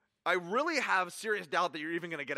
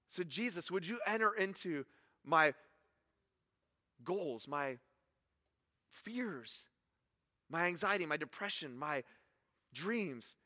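soft, hushed prayer to Jesus; preacher softly praying to Jesus; quiet prayer; 3 semitones lower, 6 dB quieter than usual. Acted. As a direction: pray it gently, almost under the breath.

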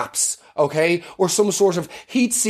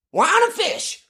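excited cry; 14 semitones higher, slower than usual. Pretend. Jesus hawking wine and fish.

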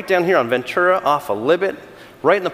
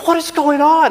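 high, loud frustrated outburst; hammed-up "what is going on"; replaying his own road rage aloud; mock exasperated yell; 10 semitones higher, 5 dB louder than usual. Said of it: "I was screaming literally in my heart".

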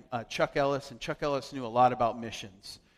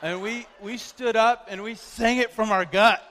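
excited; 7 semitones higher, 7 dB louder than usual. Real, not acted. Excited retelling, laughing.

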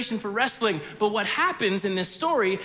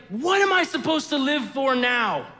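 louder, higher jealous outburst; a moderate angry rant; bitter outburst; 6 semitones higher, 5 dB louder than usual. Retold or imitated. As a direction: throw it at your sister, hurt and raised. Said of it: "he's like".

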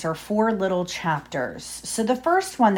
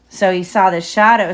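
ordinary delivery

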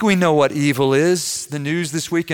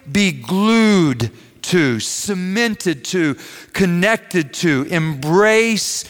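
very slow, drawn-out list; slow emphasis; much slower than usual. Real, not acted. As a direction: stretch each synonym so it sticks. Preacher dwells on each synonym.

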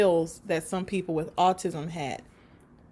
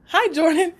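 put-on chirpy, louder hi to the boy; bright higher greeting; 8 semitones higher, 6 dB louder than usual. Greeting the boy at the door, bright and higher. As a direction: greet him brightly, pitched up. Her own greeting retold.

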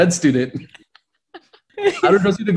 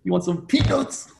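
mock air hostess offer; 6 semitones higher, 4 dB quieter than usual.

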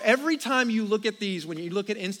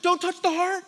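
much higher, frantic pleading voice; panicked teenage son; 10 semitones higher, 3 dB louder than usual. Retold or imitated. Son panicking as dad likes a girl's photo, high frantic. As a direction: beg him to stop, panicking. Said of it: "and the son goes".

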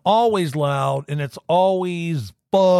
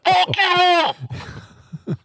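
high, distorted loudspeaker shout; through a megaphone. Acted. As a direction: yell it through a toy megaphone.

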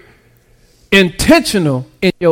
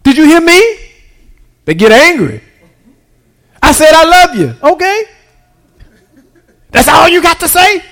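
full-force preaching; 7 semitones higher than usual. Acted. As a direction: preach it hard, lift the whole church.

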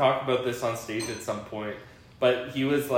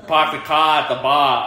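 loud mock Boston accent, dropped Rs; 3 semitones higher, 9 dB louder than usual. Dad mocks his kids' speech, loud R-less Boston accent. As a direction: imitate kids with a broad Boston accent. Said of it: "are somehow from Boston".

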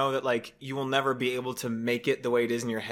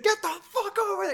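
mock angry yell. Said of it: "he's like".

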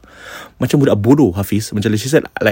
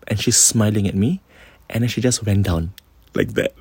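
normal talking voice

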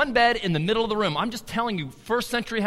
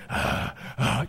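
heavy breathless gasps, imitated; exhausted huffing in place of words; winded panting; 7 semitones lower, 5 dB quieter than usual. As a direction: mimic gasping for air mid-workout. Imitating exhausted man during workout.

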